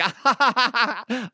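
high, hammed-up cackle; mock laughing fit. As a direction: fake a helpless fan's laugh. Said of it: "I can't stop laughing".

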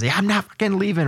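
movie quote. Retold or imitated. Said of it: "from, uh, Wolf of Wall Street".

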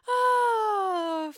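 high exasperated cry; squeal. Real, not acted. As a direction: wail at the drama. Reacting to party drama.

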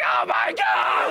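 breathless mock shriek, slow; screaming fan. Comedian and Tammy at the stage, mock fan scream. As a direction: squeal like a fan losing it. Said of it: "we were like".

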